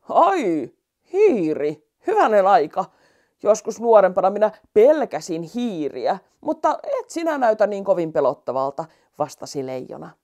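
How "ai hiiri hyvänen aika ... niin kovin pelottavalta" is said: put-on louder, surprised old lion. Old lion surprised to see a mouse, louder. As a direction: play the tired lion, startled then amused.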